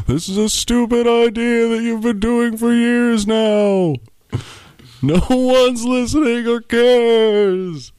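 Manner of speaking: very high, slow ghostly voice. Acted. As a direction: drawl it out like a spooky ghost. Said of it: "It's the ghost talking".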